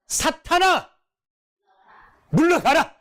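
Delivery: Jesus rounding on Peter in anger; angry command. Retold or imitated. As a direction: drive Satan away with a sharp order. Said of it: "확 돌았습니다".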